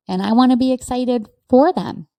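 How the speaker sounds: ordinary talk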